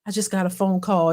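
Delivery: low, subdued reply; acting out a man's muted answer; retold grieving boyfriend. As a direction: drop the voice, play him downcast. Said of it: "He was like".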